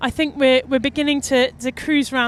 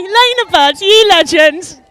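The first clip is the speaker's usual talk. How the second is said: cheering; 5 semitones higher, 8 dB louder, slower than usual. Real, not acted. Cheering the winner alongside.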